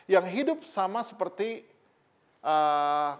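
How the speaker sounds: ordinary delivery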